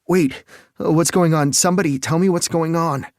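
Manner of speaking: high, loud frantic pleading; desperate, voice climbing; panicked. Acted. Panicking husband demanding answers.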